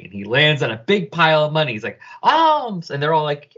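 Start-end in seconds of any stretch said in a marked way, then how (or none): 2.2-2.9 high, elated exclamation; joyful cry. Acted. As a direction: cry it out high as if landing on treasure. Native lands on money pile.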